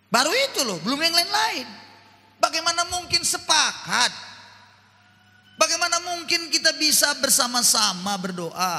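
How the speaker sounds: high, loud pulpit appeal; preaching at full force. Acted. Preacher challenges the congregation loudly about praying together. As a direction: press the congregation hard.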